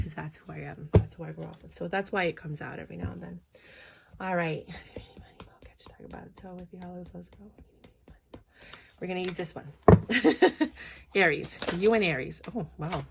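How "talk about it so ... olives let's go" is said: much softer, half-whispered aside; hushed muttering. Real, not acted. Reader to herself while handling the cards, hushed. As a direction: murmur it almost under your breath.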